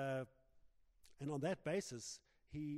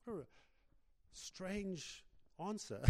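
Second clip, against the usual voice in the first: ordinary delivery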